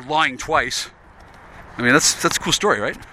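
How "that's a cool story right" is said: usual speaking voice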